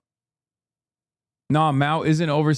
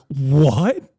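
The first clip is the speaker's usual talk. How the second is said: mock surprised what; 5 dB louder than usual. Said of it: "He's like".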